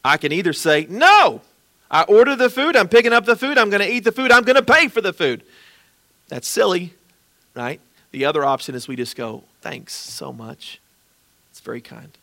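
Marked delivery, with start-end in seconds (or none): 0.9-5.4 much higher and louder, hammed-up insistence; mock indignant refusal. Pastor mocking a proud refusal of a free meal, loud and high. 9.6-12.1 gentle thanks. Pretend. Gracious acceptance of gift.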